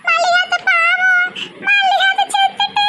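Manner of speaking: very high, drawn-out giggly wail; laughing at death news. Acted. Daughter pitying dead aunt to mother, laughing. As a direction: offer pity while cackling helplessly. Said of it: "எதுக்கு சிரிச்சிட்டு கிடக்க".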